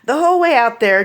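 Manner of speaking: ordinary talk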